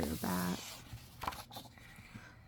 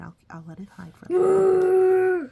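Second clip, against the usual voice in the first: hammed-up whiny wail, much higher and louder; mock crying; 10 semitones higher, 13 dB louder than usual. Parent pretends to cry to the child over the hidden bear.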